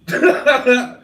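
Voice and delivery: short, high, loud laugh. Real, not acted. Host reacting to the guessing game, a quick high laugh. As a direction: burst out in a rapid little laugh.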